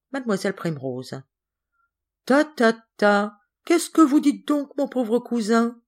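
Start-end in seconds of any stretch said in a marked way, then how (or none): 2.2-5.8 high, drawn-out tut-tutting; dismissive scolding. Acted. Cousin contradicting the father's blame of Geneviève, dismissive. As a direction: brush the accusation aside, pitched high and slow.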